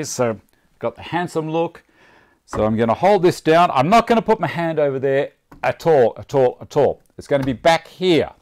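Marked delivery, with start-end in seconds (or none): 2.4-8.3 loud voice over the saw noise; shouting over table saw. Real, not acted. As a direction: talk loud with earmuffs on. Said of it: "so I stop talking too loud".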